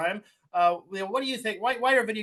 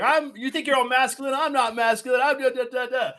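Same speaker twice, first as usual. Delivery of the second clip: higher-pitched mocking imitation of a touchy friend; mock defensive; 5 semitones higher than usual. Co-host mimics his friend arguing about masculinity, mock defensive. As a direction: imitate someone getting defensive, pitched up, trailing into babble. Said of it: "getting so, like, defensive and saying".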